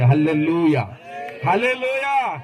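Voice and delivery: ringing pulpit shout of praise; loud hallelujah call. Real, not acted. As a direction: rouse the congregation with hallelujah. Preacher calls out hallelujah to congregation.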